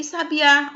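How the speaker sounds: "sabiá" with the stress on final Á; stress on last syllable. Shown as a demonstration. Teacher contrasting lookalike words, "sabiá" stressed on the end. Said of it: "diferença é a sílaba tônica".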